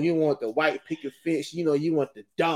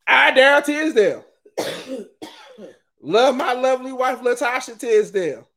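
loud, higher public announcement; proud proclamation; 9 semitones higher, 6 dB louder than usual. Pretend. Husband proclaiming love for his wife on social media, loud. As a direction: declare it to the whole neighborhood.

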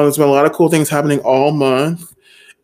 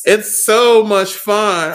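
sarcastic; 5 semitones higher, 4 dB louder, much slower than usual. Real, not acted. Sarcastic slow reply.

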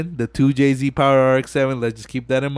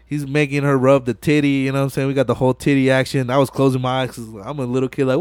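ordinary delivery

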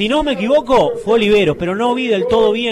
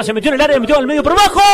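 building louder toward the goal; rising excitement; 4 semitones higher, 5 dB louder, much faster than usual. Real, not acted. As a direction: climb in pitch as the chance builds. Build-up right before the goal.

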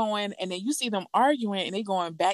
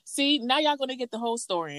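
higher, mad retelling; imitated anger; 6 semitones higher than usual. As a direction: act out her fed-up warning. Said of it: "She's mad. She's like".

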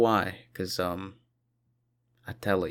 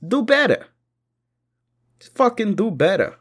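loud, high fed-up yell; exasperated shout; 13 semitones higher, 7 dB louder than usual. Real, not acted. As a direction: yell it at every bad driver. Ranting at bad drivers.

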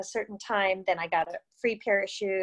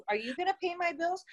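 higher, quieter incredulous challenge; pointed follow-up; 8 semitones higher, 4 dB quieter than usual. Retold or imitated. To coach Joe on quitting her job, higher mock challenge. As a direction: throw the question back at him, pitched up. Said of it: "like, shocked".

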